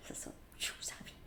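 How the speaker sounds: whispered with a giggle; breathy laughing aside. Real, not acted. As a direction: giggle it under your breath.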